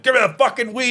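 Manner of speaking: high, hammed-up angry demand; the dad demanding the weed back; mock angry demand. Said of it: "and he's like".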